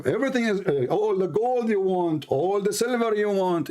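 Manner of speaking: usual speaking voice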